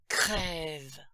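very loud, venomous demonic shout; spat out. A narrator's reading. Evil voice curses the exorcist's helpers, spitting it out. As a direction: spit the word out with full force. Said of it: "cracha alors la voix".

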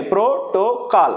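ప్రోటోకాల్ slowly as three beats, ప్రో-టో-కాల్; syllable-by-syllable demo. Shown as a demonstration. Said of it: "three syllables ఉంటాయి".

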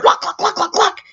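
rapid-fire high repeated "lock"; imitated lock clicks. Storyteller imitating the door being locked, rapid and high. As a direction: fire the words off like bolts shutting. Imitates locks snapping shut.

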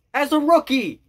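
high, loud, incredulous outburst; real amazement, pitch jumping way up; excited disbelief. Real, not acted. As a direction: shout it out, can't believe it. Repeats it shouting in disbelief.

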